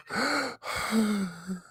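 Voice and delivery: high, sharp theatrical gasp; dramatic gasp. Acted. Cohost reacts to a caller's profile name, high mock-shocked gasp. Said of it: "Why'd you make that noise?".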